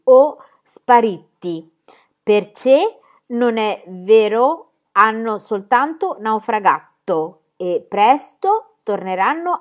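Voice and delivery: slow, emphatic reading of a written note; measured, deliberate letter-reading with long stresses; letter read aloud. A narrator's reading. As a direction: spell it out slowly, phrase by phrase.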